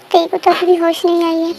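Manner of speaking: ordinary delivery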